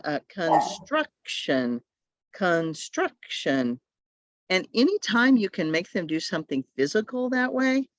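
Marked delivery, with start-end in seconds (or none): 0.3-3.8 construction drawn out very slowly, syllable by syllable; stretched syllables. Shown as a demonstration. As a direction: stretch construction like a rubber band. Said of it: "use a rubber band".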